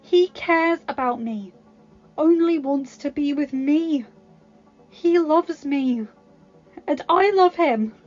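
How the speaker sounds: high, loud and emphatic, slowing on key lines; impassioned rejection. Acted. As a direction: tell him off, then declare love. Belle rejects Gaston.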